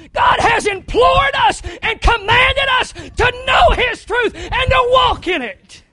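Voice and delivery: loud, high, shouting sermon voice; full-force preaching. Acted. Preacher shouting to his church about rejecting truth, full-force preaching. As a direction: drive it home from the pulpit.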